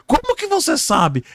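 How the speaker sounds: very high-pitched surprised question; acted astonishment. Rescuer found out by the victim, astonished. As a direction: squeal the question in disbelief.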